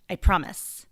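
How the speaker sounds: normal talking voice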